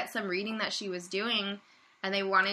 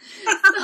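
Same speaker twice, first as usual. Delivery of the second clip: loud laugh; 12 semitones higher, 11 dB louder than usual. Real, not acted.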